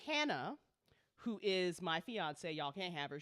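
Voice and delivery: ordinary delivery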